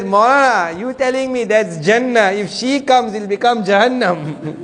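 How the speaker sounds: high, excited mock protest, laughing at the end; hammed-up jolly uncle. Jolly uncle jokes about wife.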